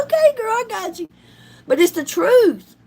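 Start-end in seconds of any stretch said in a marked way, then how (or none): 0.0-1.1 higher, quick laughing answer; laughing reply. Retold or imitated. Telling her mom she's got it, laughing.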